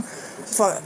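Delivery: ordinary talk, nothing marked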